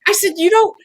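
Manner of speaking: begging the doctor to understand, near tears; retold tearful plea. Patient pleads with the hip surgeon, tearful and high. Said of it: "I just kinda burst into tears".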